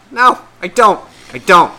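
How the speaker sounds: high, loud, emphatic denial; re-enacting an indignant no; loud flat-out refusal, retold. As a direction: shoot it down loudly, twice. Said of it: "I said it exactly like that".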